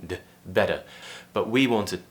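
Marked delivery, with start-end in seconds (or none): 0.0-0.8 "better" with a quick D-like tap; the isolated D, then "better" as "bedder"; flapped T. Shown as a demonstration. Teacher demonstrates the tongue tap, "better" with flapped T. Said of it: "It's just a quick tap of the tongue".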